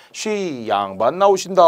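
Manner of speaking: high, stretched-out performer's call; herald's announcement. Acted. Mask-dance servant calls the audience to attention, announcing. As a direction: call out the nobleman's entrance, drawing out the words.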